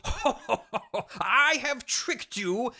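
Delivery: high, slow theatrical gloating; hammed-up villain laugh into a slow reveal; Doctor Marv, villainous trickster. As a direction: gloat grandly with a mock ho-ho. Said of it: "He goes".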